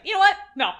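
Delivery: sudden loud "no" with a laugh; loud mock refusal. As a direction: blurt it out, laughing. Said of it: "they're just like".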